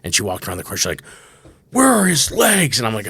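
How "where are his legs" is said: high, slow, blurted-out question; loud, tactless child. Retold or imitated. Daughter spotting a man in a wheelchair, loudly blurting. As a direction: call it out high with no tact.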